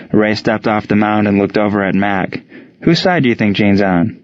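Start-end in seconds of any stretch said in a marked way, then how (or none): none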